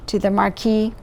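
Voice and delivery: ordinary delivery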